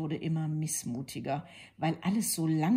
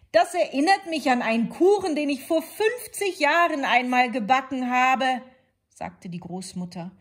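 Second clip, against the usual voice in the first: put-on higher, louder old lady voice; chatty granny recalling old times; lightly voiced reminiscing grandmother; 7 semitones higher, 7 dB louder than usual. A narrator's reading.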